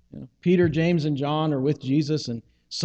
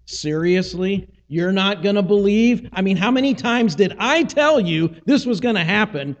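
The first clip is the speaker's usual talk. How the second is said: exasperated, rising higher and louder to the end; ticked off; 4 semitones higher, 3 dB louder than usual. Acted. Jesus to the disciples who won't believe, ticked off. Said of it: "Jesus is a little ticked off".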